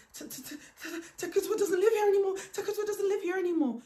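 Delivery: high, fast, stammering and near tears; frightened woman at the door, hyperventilating and stammering; shaking panic. Retold or imitated. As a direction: gasp the words out, terrified. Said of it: "She's shaking at the door".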